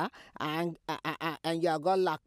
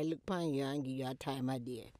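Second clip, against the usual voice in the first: ordinary delivery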